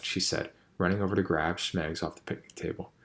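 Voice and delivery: ordinary delivery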